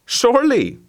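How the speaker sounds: Surely jumps high and falls, eager yelp. A narrator's reading. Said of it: "eager dog repeating himself".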